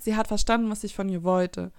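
ordinary talk, nothing marked

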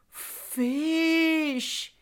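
high, drawn-out FISH; one long, lifted, mouth-watering cry; relishing exclamation. A narrator's reading. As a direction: savour the word, stretched long and high. Savouring the word fish, bear tempted.

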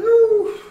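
very high, loud squeal; mock pained ooh. Imitating himself crying over hot Cheetos with a very high squeal. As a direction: squeal like the heat hurts. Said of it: "I was like".

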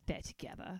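hushed, unvoiced whisper; whispered realisation. Retelling her live-tweet hunch about the couple, whispered. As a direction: whisper it like a secret just spotted.

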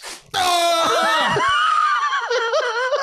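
high shrieking burst of laughter. Real, not acted. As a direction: howl with laughter as the strip rips. Wax strip ripped, group erupts.

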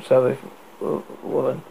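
ordinary delivery, nothing marked

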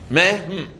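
"meh, mm": open the mouth on "meh", close it into "mm"; Mem sound demo. Shown as a demonstration. Rabbi repeating the Mem demo for the class. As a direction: sound out "meh, mm" slowly, opening then closing the lips. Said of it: "you open your mouth and you close your mouth".

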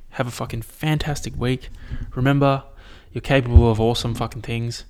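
usual speaking voice

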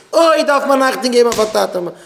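loud, high preaching cry; shouted admonishment. Real, not acted. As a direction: cry out the warning to the crowd. Repeats the warning, now shouting.

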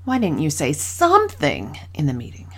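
whispered, with "something" leaned on; hushed question that stresses "something"; breathy whisper, weight on "something". Shown as a demonstration. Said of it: "six different ways I said the same question".

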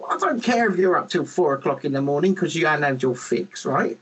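ordinary talk

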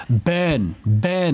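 "Ben, Ben" with a short E vowel instead of the R sound; imitating co-host's vowel. Teasing co-host by copying how "Ben, Ben" was said, with an E. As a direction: say "Ben, Ben" like "burn" said with an E. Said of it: "the way you said burn was".

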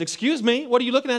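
high, sharp indignant wife; a wife catching her husband staring. Retold or imitated. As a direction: call him out, offended and high-pitched.

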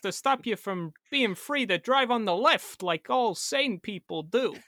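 exaggerated swooping, oddly paused actor voice; Christopher Walken. Challenging cohost to drive left, mock actor impression. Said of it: "only do a Christopher Walken accent".

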